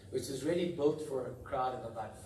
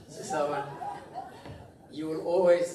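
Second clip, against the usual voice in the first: loud; 3 semitones higher, 8 dB louder than usual. Real, not acted. Honouring the boss aloud.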